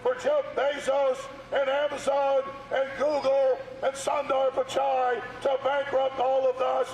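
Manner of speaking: real protester shouting accusations. Real, not acted. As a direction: project every charge at the building. Haranguing the mayor at a protest.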